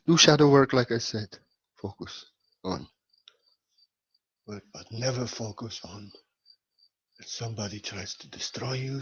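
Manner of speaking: low, measured guru teaching; deep-pitched, earnest meditative delivery; calm spiritual guidance. Real, not acted. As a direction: guide listeners deliberately, with pauses.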